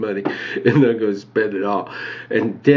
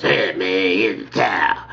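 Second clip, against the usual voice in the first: put-on old guy; 3 semitones lower than usual. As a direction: lower put-on old man's voice. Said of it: "The old guy".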